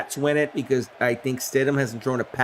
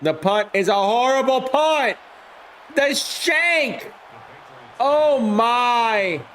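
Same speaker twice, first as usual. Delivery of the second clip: high, loud call that bursts into a shout at the shank; stunned commentator yelling at a shanked punt; excited play-by-play; 9 semitones higher, 3 dB louder, slower than usual. Acted. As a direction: react to a horrible punt like a live announcer. Calls the bad punt live.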